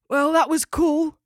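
slow, high, loud kangaroo; impressed and laid-back; character voice. A narrator's reading. Steve voiced.

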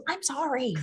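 barely voiced, nearly silent; mouthed apology. Player's character mouthing sorry to Mark, nearly silent. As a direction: mouth the words to him. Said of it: "And then I mouth to him".

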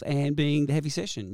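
ordinary talk, nothing marked

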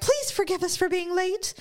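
high-pitched mock plea for forgiveness; loud apology. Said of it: "I walked in very loudly".

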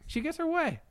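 high, soft haughty royal voice; lightly voiced smug queen mother; mock Queen Mother. Host mimics the Queen Mother getting her way, high and soft.

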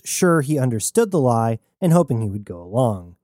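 usual speaking voice

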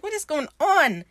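high-pitched incredulous question; mock alarm. Danes seeing fake intestines pulled out, mock alarm.

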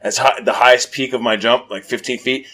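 ordinary delivery

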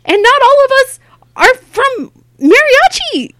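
high-pitched, loud comic scolding; mock self-scolding. Comic telling herself off for agreeing, mock scolding. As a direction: berate yourself in a high, punchy voice.

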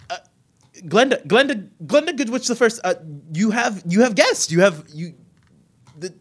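high, loud stammering guard; flustered guard. Acted. Guard announcing guests to Glenda, high flustered stammer. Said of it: "the two guards ... pointing".

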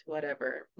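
low, soft dismissive brush-off; mock a hardened shrug of a reply; armored indifference. Woman showing her hard layer of armor, softly dismissive. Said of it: "built up this, like, hard layer of armor".

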